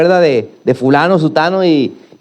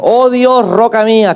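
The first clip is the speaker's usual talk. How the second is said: higher, louder cry to God; a psalmist's raised plea; 8 semitones higher, 4 dB louder, slower than usual. Acted. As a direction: call out to God in anguish. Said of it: "clamó".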